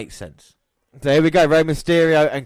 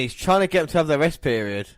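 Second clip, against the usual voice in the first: normal talking voice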